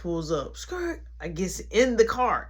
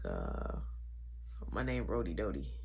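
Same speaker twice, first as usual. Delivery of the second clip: much lower, softer smooth-talker voice; deep mock-smooth voice; 14 dB quieter than usual. Meeting the pretty girl, deep mock-smooth intro. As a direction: drop way down to sound smooth.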